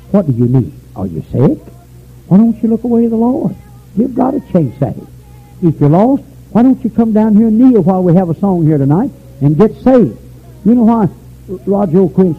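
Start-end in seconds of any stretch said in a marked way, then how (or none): 2.3-12.3 high, loud pleading invitation; impassioned altar call. Acted. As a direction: urge the lost to come forward. Altar call, preaching at full force.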